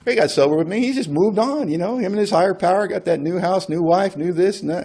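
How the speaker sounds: high, whining self-pity; mock indignant whine of a jealous friend; exasperated inner voice. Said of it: "I'm thinking".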